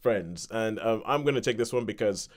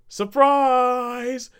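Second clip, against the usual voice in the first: much higher, loud group yell; surprise shout; 13 semitones higher, 7 dB louder than usual. Retold or imitated. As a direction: burst out with it as the door opens. Guests shout at surprise party.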